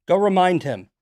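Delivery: loud, high barked order; angry shout. Real, not acted. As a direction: bark it out in anger.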